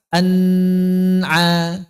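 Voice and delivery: "an'a" with an overlong nasal n; dengung berlebih salah. Shown as a demonstration. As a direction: hold the n of "an'a" far too long. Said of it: "Tidak dibaca". ("an'a" dengan nun ditahan panjang)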